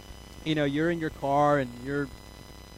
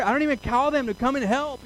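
ordinary talk, nothing marked

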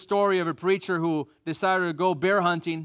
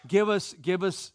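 usual speaking voice

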